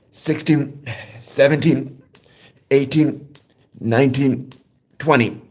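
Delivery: counting reps while doing push-ups. Real, not acted. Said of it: "All right, here we go".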